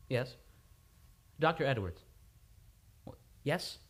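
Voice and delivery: ordinary talk, nothing marked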